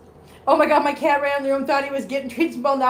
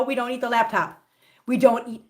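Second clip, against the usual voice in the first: usual speaking voice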